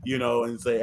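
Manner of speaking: ordinary delivery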